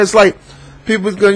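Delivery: ordinary talk, nothing marked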